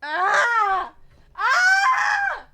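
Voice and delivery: loud, high-pitched startled shriek; scream. Real, not acted. Startled by the game's character voice.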